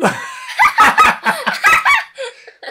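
high loud burst of laughter. Real, not acted. Laughing at child's attempt.

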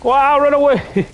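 high, excited shout to flee; excited yell. Real, not acted. Fleeing the monster.

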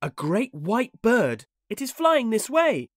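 high, loud announcement of a marvel; wondering boy. A narrator's reading. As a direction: call out the sighting in wonder.

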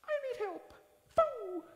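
squeaky high mock panic prayer; quick arrow prayer. Pastor mimicking a frantic silent prayer for help, squeaky. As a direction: squeak it out like a tiny desperate plea. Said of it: "you just quietly".

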